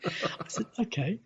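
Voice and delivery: quiet, laughing through the words; breathy laugh. Real, not acted. Retelling the heckle, softly laughing. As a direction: giggle as you say it.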